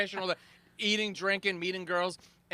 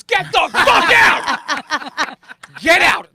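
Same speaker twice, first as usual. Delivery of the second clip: higher, much louder, drawn-out yell; furious shout; 10 semitones higher, 15 dB louder, much slower than usual. Retold or imitated. CEO throwing the crasher out, shouting. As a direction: throw him out at full voice. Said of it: "He goes".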